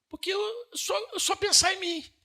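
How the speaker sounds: "eu só pensar em mim" flung high and loud; impassioned preaching cry. Acted. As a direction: cry it out from the pulpit. Preacher rising to a peak.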